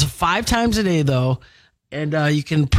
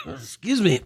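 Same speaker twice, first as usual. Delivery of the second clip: with hiccups; 4 dB quieter than usual. Real, not acted. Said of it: "I got the hiccups".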